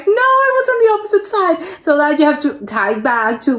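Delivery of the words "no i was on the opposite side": high, rushed cry of sudden realization; mock realization. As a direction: blurt it out fast, playing a dismayed hiker. Acts out a stranded hiker realizing the mistake.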